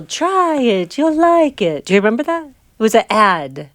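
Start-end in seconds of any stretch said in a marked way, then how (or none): 0.0-1.8 ad slogan. Retold or imitated. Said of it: "What was the commercial".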